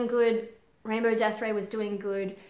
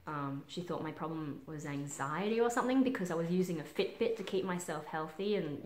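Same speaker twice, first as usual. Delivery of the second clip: usual speaking voice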